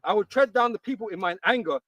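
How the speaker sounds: loud, solemn scripture reading. Acted. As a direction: read it out like holy writ. Reads scripture aloud.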